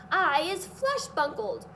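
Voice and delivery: loud, slow giant roar; furious giant roar. A narrator's reading. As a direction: bellow it out in rage. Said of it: "roared the flesh lump eater".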